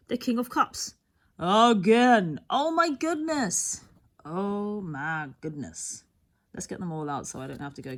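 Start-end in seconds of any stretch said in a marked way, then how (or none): 1.4-3.8 startled exclamation at the card; surprised. Real, not acted. Shocked at repeated card. 4.2-6.0 low, slow, sinking oh my goodness; resigned repeat of the exclamation; deflated. Real, not acted.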